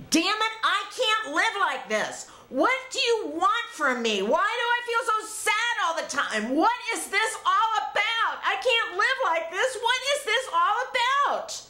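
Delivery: high-pitched, anguished angry outburst; screaming at God. Retold or imitated. Woman crying in her car demands answers from God, screaming. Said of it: "I start screaming at God".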